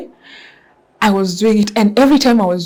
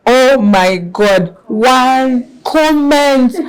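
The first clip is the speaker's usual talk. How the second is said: stunned awe; 5 semitones higher, 5 dB louder, much slower than usual. Real, not acted. Seeing the flood of comments.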